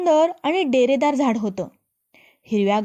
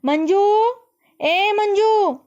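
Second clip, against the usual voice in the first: calling the maid loudly, higher; 9 semitones higher, 3 dB louder, much slower than usual. Acted. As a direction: call out across the palace, higher and louder. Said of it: "तिनं दासीला हाक मारली".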